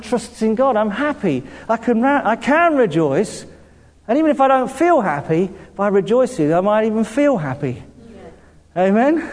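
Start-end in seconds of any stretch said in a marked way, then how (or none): none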